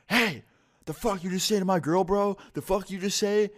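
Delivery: mock high-pitched confrontational boyfriend voice; angry boyfriend. Angry boyfriend calls out, acted.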